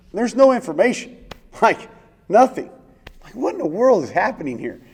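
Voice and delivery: stunned, laughing outburst; incredulous laughing disbelief. Real, not acted. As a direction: laugh it out, high and loud, can't believe it. Disbelief at sudden flight orders.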